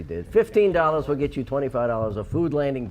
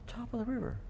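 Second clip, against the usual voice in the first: very soft, barely audible; too quiet to hear; 8 semitones higher, 13 dB quieter, faster than usual. Real, not acted. Co-host fails to be heard naming the place, too quiet. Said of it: "They can't hear you".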